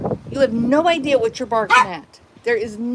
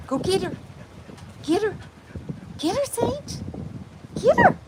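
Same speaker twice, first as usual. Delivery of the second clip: rising excited sic-em cheer; rising excited urging; 11 semitones higher than usual. Real, not acted. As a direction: egg the dog on, getting more excited.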